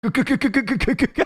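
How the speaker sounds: giggly exaggerated stammering; mock stammer. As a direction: mimic someone stuck on one word. Mimics the clip speaker's stammer.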